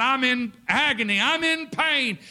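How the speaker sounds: high, pained cry, slowing on pain; retold agonized scream. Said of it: "screaming out".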